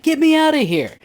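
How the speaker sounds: high-pitched panicked plea; panicked scream. Retold or imitated. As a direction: scream to be let out. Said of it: "You hear guys screaming".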